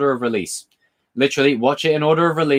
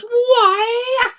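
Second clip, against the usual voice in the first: mock [name] cry. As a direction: yelp it out high as a squeal. Mimicking [name]' line again.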